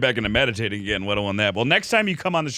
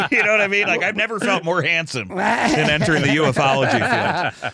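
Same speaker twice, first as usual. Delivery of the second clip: quick, higher, laughing through it; laughing joke; 4 semitones higher than usual. Real, not acted. Host joking to guest, laughing quickly. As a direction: crack up at your own joke. Host jokes about being handsome.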